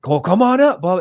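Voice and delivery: high, loud retold invitation; eager urging. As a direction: urge him up, voice pitched way high. Monk retold urging him up.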